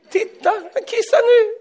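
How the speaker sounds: put-on high falsetto cooing at a toddler; doting baby talk. Preacher mocks parents cooing over a toddler, high baby talk. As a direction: gush like a doting parent in a squeaky voice.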